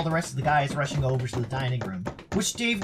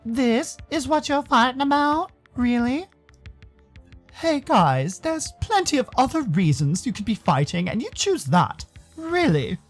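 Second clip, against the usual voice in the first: higher-pitched incredulous character voice; disapproving, exasperated figure, pitched up; scolding bystander; 7 semitones higher, slower than usual. Acted. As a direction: chide them in raised, drawn-out disbelief.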